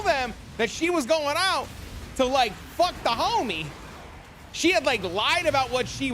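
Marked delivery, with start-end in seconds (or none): none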